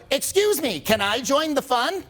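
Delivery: high-pitched, drawn-out mock plea to join in; mock polite intrusion. Coach acting out asking to join strangers' fun, mock polite. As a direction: put on a high, overeager politeness. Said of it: "if you said it like that".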